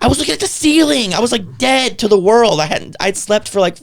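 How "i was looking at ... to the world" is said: high-pitched insistence; exasperated protest. Real, not acted. Patient defending himself over the misunderstanding, exasperated. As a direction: plead innocence, voice pitched way up. Protesting his innocence to friend.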